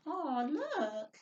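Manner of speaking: usual speaking voice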